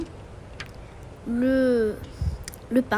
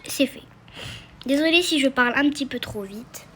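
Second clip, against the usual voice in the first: ordinary delivery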